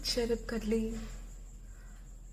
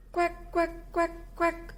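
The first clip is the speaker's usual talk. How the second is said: high quacking duck; 7 semitones higher, 4 dB quieter than usual. Acted. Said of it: "came the reply".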